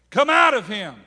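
big commanding shout; stern command. Acted. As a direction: command the demon out forcefully. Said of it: "Jesus said sternly".

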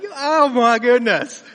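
loud, slow stunned outcry; mock shock. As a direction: drag it out in stunned betrayal. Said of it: "was like".

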